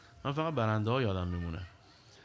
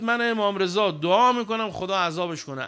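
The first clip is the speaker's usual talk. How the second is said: loud, higher, stressing دعا; emphatic quote; 7 semitones higher, 6 dB louder than usual. Acted. Preacher loudly quoting Imam Reza to the congregation. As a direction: proclaim it forcefully to the congregation.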